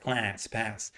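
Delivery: "class, pass" with a Californian open front A; drawn-out Californian vowel in "class, pass"; Californian accent. Shown as a demonstration. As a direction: model Californian English, vowel as in "cat". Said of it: "I teach Californian English".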